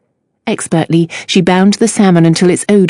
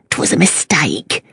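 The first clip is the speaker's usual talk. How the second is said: put-on low mumbling Cockney accent; Cockney mumble; 3 semitones lower, 5 dB quieter, slower than usual. A narrator's reading. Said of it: "mumbled in his thick Cockney accent".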